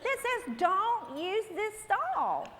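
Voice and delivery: high, drawn-out comic exasperation; exasperated scolding. Retold or imitated. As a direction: retell an incredulous, high-pitched telling-off. Acted scolding about the unmarked stall.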